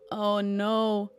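ordinary talk

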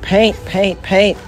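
yelling. Acted. Clip voices.

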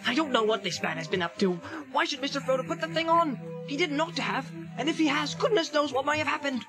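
quick, indignant hobbit protest; fast, worked-up Sam voice; agitated outcry. A narrator's reading. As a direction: rattle it off in alarm. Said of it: "cried Sam, jumping up".

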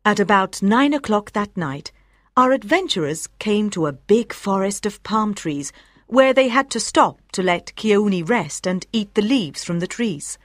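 usual speaking voice

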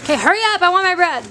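high, loud and impatient; eager impatient. Real, not acted. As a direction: demand the bread eagerly. Impatient for bread.

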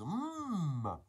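long Italian-style mmm, rising high; Italian accent. Acted. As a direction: savour it like a hammed-up Italian. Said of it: "we all have an Italian accent".

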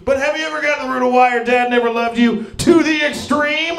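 high, fast, hyped-up shouting; extreme ad shout. Acted. Energy drink therapist sells therapy to the extreme, shouting. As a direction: shout it like an extreme sports advert, stretching the last word.

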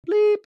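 high, loud bleep tone imitating a TV censor; mimicked censor bleep. Recapping the reunion, she mimics the TV censor's high bleep. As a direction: beep it out like the censor does. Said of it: "So they keep bleeping the one name".

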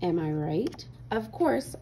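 ordinary delivery, nothing marked

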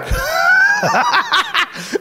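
big shrieking laugh; high laugh. Real, not acted. Laughing at shouting man.

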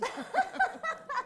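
high stoned giggles; giggling fit. Acted. Woman tickled by her own alibi idea, giggling. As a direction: burst into helpless, drugged laughter. Two women giggling after smoking herbs.

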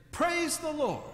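high, loud call to go; urging horse on. A narrator's reading. Preacher calls out to start his horse, high and loud. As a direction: spur the horse on with it.

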